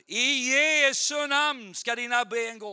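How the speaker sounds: loud, high, drawn-out faith healer's command; commanding healing prayer. Retold or imitated. Healer commands the boy's legs to walk, loud commanding prayer. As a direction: belt it out slowly over the sick.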